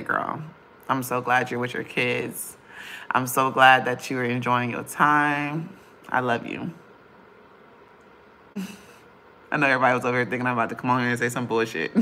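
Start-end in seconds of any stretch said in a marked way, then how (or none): none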